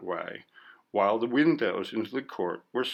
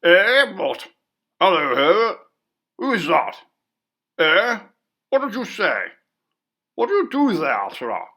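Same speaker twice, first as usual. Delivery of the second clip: loud, much higher startled calling out; great shout; 8 semitones higher, 9 dB louder than usual. A narrator's reading. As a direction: bellow into the dark room. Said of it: "shouted out in his great voice".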